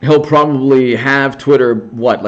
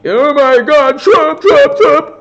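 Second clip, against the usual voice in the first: very high, louder mocking falsetto; mock dramatic swooning; 14 semitones higher, 4 dB louder, slower than usual. Reporters swooning over Trump, mocked in a loud high falsetto.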